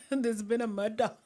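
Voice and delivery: lightly faked Taggart-style Scottish accent; a touch of mock Scottish. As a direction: try a bad Scottish detective accent, breaking into laughter. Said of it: "did you type that in a Scottish accent?".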